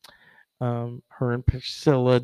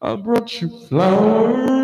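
higher, slow Elvis impression; 9 semitones higher, 5 dB louder than usual. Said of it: "[name]".

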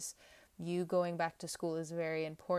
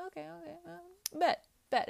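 eager acceptance; 12 semitones higher, 3 dB louder than usual. Acted. Tarot reader acting out taking on a goal, high and eager. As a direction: pitch it way up, eagerly taking on a challenge.

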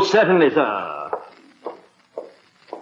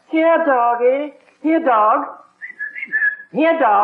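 higher, sweetened coaxing call; coaxing a dog; 6 semitones higher, slower than usual. Acted. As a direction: lure the dog gently.

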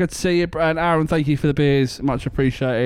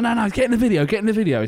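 higher, eager push to join the video; his mate egging him on; 8 semitones higher, faster than usual. Retold or imitated. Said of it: "his mate was like".

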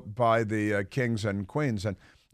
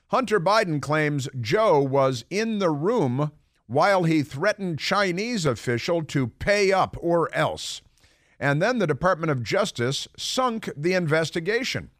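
headline read; 6 semitones higher than usual. Real, not acted. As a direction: read out a dramatic headline, word by word. Reads out a news headline.

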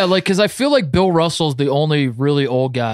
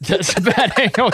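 laughing echo; 8 semitones higher than usual. Retold or imitated. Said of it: "Hubie imitation echoed".

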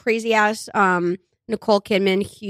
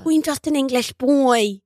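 slow mock Dublin accent; Barry Keoghan; 3 semitones higher, slower than usual. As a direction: imitate a Dubliner failing to sound English. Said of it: "he just has a Dublin accent".

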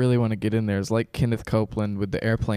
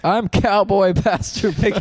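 slow southern cowboy preacher; 7 semitones higher, 5 dB louder, slower than usual. Pretend. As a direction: drawl it out like a cowboy pastor. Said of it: "comes on with a cowboy hat".

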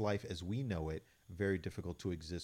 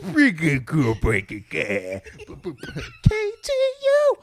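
loud, high, slow DJ shout; radio DJ voice; 15 dB louder, much slower than usual. Acted. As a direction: hype up a station call. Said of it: "I was".

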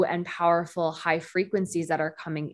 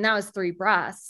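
ordinary talk

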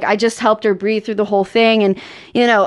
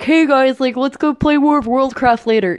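dork; 3 semitones higher than usual. Acted. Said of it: "you're not, like, a full-on dork".

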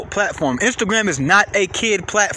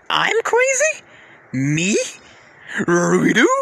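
outraged disbelief at being called crazy; incredulous; 2 semitones lower, slower than usual. Real, not acted. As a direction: pitch leaps way up in disbelief.

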